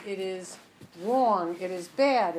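ordinary delivery

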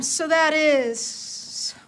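usual speaking voice

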